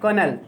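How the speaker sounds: कर्नल said as kernel, the L silent; correct pronunciation. Shown as a demonstration. Teacher showing students the correct pronunciation of कर्नल. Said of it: "कर्नल इसका शुद्ध उच्चारण है".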